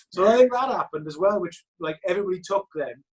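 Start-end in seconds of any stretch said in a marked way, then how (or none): none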